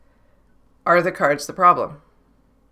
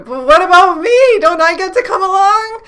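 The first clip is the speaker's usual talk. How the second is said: much higher and louder, a pleading little voice; whiny ego voice; 12 semitones higher, 6 dB louder than usual. Pretend. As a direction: whine like an ego left out of the fun. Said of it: "is the ego kinda going".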